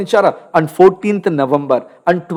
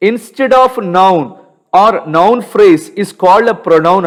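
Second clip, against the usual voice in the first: slow dictation; much slower than usual. Shown as a demonstration. Dictating a definition to students.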